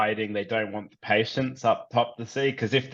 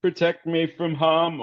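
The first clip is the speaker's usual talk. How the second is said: repeated higher, a frightened plea; quick anxious repeat of the prayer, higher; reflexive prayer; 8 semitones higher, 4 dB louder than usual. Acted. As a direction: blurt the prayer again, pitched up. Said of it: "almost kinda, like, reflexively".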